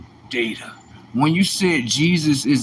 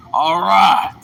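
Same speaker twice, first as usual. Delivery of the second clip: normal talking voice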